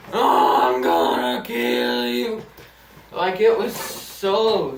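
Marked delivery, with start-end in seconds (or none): 0.1-2.4 low, slow mock menace; mocking threat. Imitating the bully's lame threat from under the pile. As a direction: drag it out like a dumb villain. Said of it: "he just goes".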